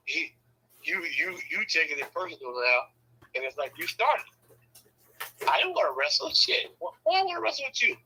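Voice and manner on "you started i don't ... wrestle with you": much higher-pitched complaining retort; mock whiny protest. Retold wrestling quarrel between husband and wife, high mock protest.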